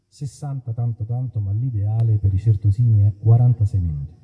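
usual speaking voice

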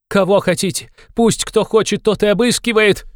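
a furious woman shouting far up in pitch. A narrator's reading. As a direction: yell the challenge at everyone. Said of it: "кричала Катерина Ивановна".

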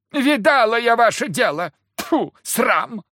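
high, loud woman's scolding; shrill retort. A narrator's reading. Wife shouts back at her husband, high and loud.

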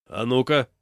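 loud, assured order; confident command. A narrator's reading. Big man clearing himself space, commanding. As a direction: take charge loudly. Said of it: "уверенно расчищая себе плацдарм".

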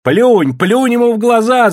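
high, loud egging-on; women shouting. A narrator's reading. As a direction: goad her from the crowd. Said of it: "закричали бабы".

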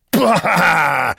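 loud, high scornful outburst; angry. A narrator's reading. Roux dismisses Duclos's excuse, angrily. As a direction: explode with contempt. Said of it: "said Roux angrily".

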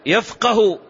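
يفقه said high as "yafqahu", a after the qaf; fatha on qaf. Shown as a demonstration. Teacher giving the present tense, يفقه pronounced "yafqahu". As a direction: give يفقه a clear "qa" syllable. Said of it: "بفتحها".

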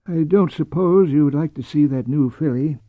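toneless, noncommittal invitation; old man's unreadable, flat delivery; flat offer. Acted. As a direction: offer it without a hint of preference. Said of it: "His tone was so flat".

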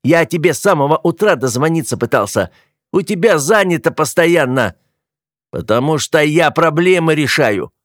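indignant, worked-up bickering; heated quarrel. A narrator's reading. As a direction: argue back in exasperation. Brothers quarrel on the stairs.

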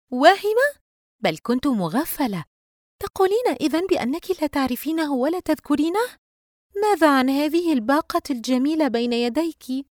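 high, loud incredulous echo, then pressing; sarcastic. A narrator's reading. Friend challenges Yusra's denial about the bouquet, loudly sarcastic. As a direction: throw it back with biting sarcasm. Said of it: "دينا بتهكم".

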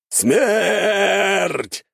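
high, long, loud battle roar; roared war cry. A narrator's reading. Hundreds of soldiers waving weapons, roared war cry. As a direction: bellow it like a charging army. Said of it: "взревели сотни солдат".

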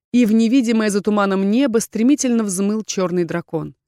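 usual speaking voice

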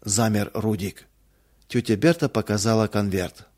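ordinary delivery, nothing marked

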